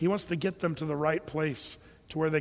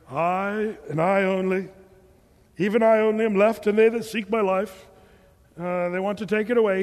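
rehearsed grievance; 5 dB louder than usual. Retold or imitated. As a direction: declaim a well-practised self-pitying case. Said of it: "he's been rehearsing that in his mind".

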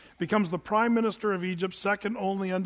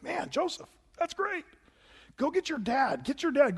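mock-excited king, pitched higher and lighter; hammed-up eager Pharaoh urging; a king delighted at good news, playfully higher; 7 semitones higher than usual. Pharaoh voiced excitedly in retelling.

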